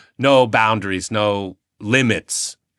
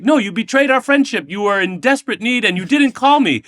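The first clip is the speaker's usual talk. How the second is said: much higher, indignant reproach; hurt friend; 12 semitones higher than usual. Retold or imitated. Russian friend accusing him of betrayal, indignant. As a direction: play the betrayed friend, wounded. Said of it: "They're like".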